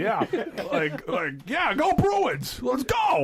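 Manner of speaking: mock Boston fan. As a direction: cheer like an over-the-top Boston sports fan, half laughing. Mocking a Boston sports fan.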